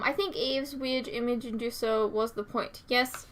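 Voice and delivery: usual speaking voice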